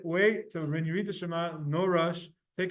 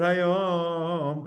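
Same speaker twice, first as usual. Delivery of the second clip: "hayom" held, then a clear break; chanted "hayom" with a long last syllable; pause after hayom. Shown as a demonstration. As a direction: draw out "hayom" and stop. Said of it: "should pause after hayom".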